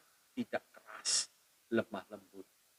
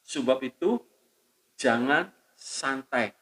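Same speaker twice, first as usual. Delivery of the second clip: normal talking voice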